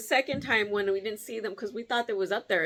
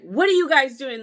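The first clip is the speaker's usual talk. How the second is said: loud demanding question; loud confrontation; 4 semitones higher, 9 dB louder than usual. Retold or imitated. Confronting teens over the fence, loud demand.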